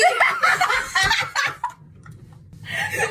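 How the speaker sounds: very high, long cackling laugh; a long, high shriek of laughter. Real, not acted. Laughing during the accent comparison.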